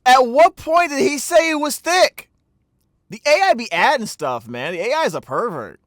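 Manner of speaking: high, loud outburst of protest; loud disbelief. Real, not acted. Player objecting to the narrator's wording, loud and incredulous. Outraged at the word thick.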